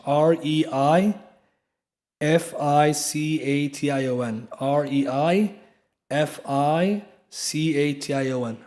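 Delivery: reification spelled slowly as R E I F I C A T I O N, one letter at a time. Shown as a demonstration. Teacher spelling the term for students, slow and clear. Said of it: "This is called a fallacy of reification".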